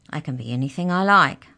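a hint of a mouse's squeak; squeaky mouse. A narrator's reading. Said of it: "it squeaked".

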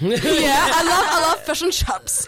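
put-on broad comic New Zealand accent; amped-up New Zealand accent. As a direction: lay the New Zealand vowels on thick, slowly. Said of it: "I amp up my New Zealand accent".

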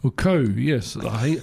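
usual speaking voice

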